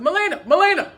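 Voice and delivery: calling to get her attention. Retold or imitated. Man calls Melina over to him, calling out.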